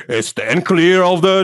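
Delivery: slow, mock male subway warning; imitating the train's male announcer, slow; subway man's voice. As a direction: read out the doors warning like the recorded man. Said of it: "sale la voz de un hombre".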